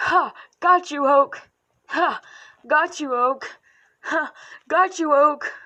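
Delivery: loud triumphant gotcha; gleeful taunt. Pretend. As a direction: tease with a gloating laugh. Voice acting taunting lines.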